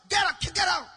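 high, loud repeated order; angry shout. Retold or imitated. Preacher ordering the woman out in anger, angry shout. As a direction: bellow it twice to drive them out. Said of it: "Then I cooled down".

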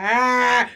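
hammed-up crying sound; mock deep cry. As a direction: break into a put-on bawl. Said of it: "that cry where it's in depth".

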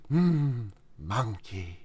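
low soft drawn-out monkey; imitating a slow, sleepy Turtle, very soft; Turtle imitation. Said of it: "like, clip from the Kung Fu Panda show".

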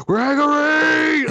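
high, long-held mock yell; imitating his own exasperated shout; yelling a name. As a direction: holler the name, stretching the last syllable. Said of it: "yelling his name out".

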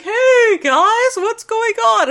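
high, loud cheery greeting; oblivious Sandstorm. Retold or imitated. Sandstorm wakes up and greets the defeated team, cheery. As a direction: burst in clueless and chipper. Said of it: "Sandstorm wakes up and he's like".

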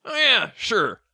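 high, loud put-on satyr voice; satyr bartender. Satyr bartender serves a drink, high character voice. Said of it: "the satyr will come up to you".

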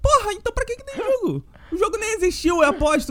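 high-pitched incredulous ranting; worked-up, half-joking outburst; comic exasperation. Pretend. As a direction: protest in disbelief, pitch jumping up. Comic rant about unseen match.

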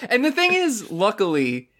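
usual speaking voice

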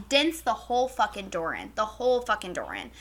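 ordinary delivery

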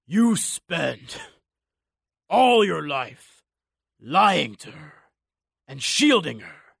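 slow rasp through blood; raspy dying voice. Acted. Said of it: "voice rasped out through blood".

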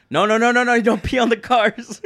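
high, loud rapid-fire no-no-no; retold alarmed yell. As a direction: yell it like stopping a drunk friend. Retold panic stopping a drunk friend.

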